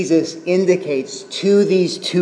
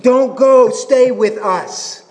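urgent pleading; 6 semitones higher, slower than usual. Acted. Disciples begging Jesus to stay, urgent pleading. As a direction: beg him not to leave, drawing out the last words. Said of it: "It's pleading".

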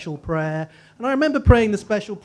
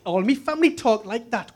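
broad Northeastern English, a little higher; Northeastern English accent; 3 semitones higher than usual. Shown as a demonstration. Speaker shows an audience the family's talk, Northeastern English accent. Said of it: "a really good Northeastern accent".

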